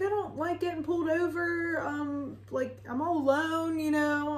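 high, nervous imitation of herself; shaky nerves. Lone driver explains her nerves to the officer, in a high, nervous voice. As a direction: retell an anxious excuse, pitched well up. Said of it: "Why are you shaky".

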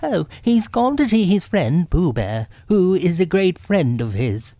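high, busy rabbit voice; fibbing rabbit. Acted. Rabbit fibbing to the bear outside, high character voice. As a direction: explain it away in a high voice.

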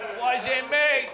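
loud, high, drawn-out denial. Acted. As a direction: protest your innocence to the cops. Guilty denial gag.

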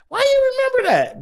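high-pitched mock mom voice, puzzled; imitating mom. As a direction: play his mother asking, voice jumped way up. Mom quoted in childhood story.

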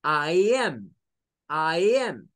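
I am with am between a and e; American I am; short a I am. Shown as a demonstration. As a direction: say am with a short a mixing a and e. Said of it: "la A casi no baja".

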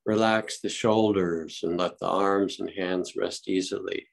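unhurried calm meditation guide; guided meditation. Acted. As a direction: ease the group gently into stillness.

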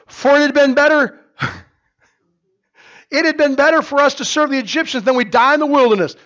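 loud, high accusing rant; angry complaint. Acted. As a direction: blame Moses bitterly. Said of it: "they cried out".

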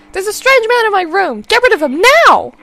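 high, loud, frantic cries; terrified old woman yelling for staff; panicked shouting. Acted. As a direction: scream that a stranger is in the room. Mother screams for help.

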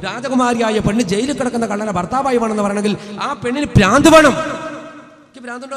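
loud, angry shouting at his daughter; furious father yelling. Acted. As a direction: roar it at her in a rage. ഒരു അടിയാണ്ട് വെച്ച് കൊടുത്തിട്ട് പറഞ്ഞു. (ദേഷ്യത്തോടെ ഉറക്കെ അലറി)

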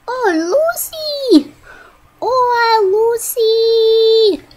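high, loud cooing call to the dog; high, loud excited call to Lucy. Real, not acted. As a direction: greet the dog with delight.

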